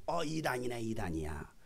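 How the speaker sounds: low, hushed disapproval; muttered accusation. Retold or imitated. Critics judging the preacher, muttered accusation. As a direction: mimic gossiping accusers under the breath.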